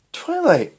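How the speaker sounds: high surprised call of a name. Acted. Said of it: "said Cadance, surprised".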